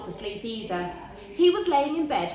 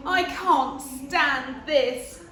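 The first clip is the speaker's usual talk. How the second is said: slow, higher, louder weary complaint; fed-up Mister Bear; 4 semitones higher, 4 dB louder, much slower than usual. Acted. Mister Bear, kept awake, moans slowly and loudly. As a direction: drag it out, fed up and sleepless. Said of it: "said Mister Bear".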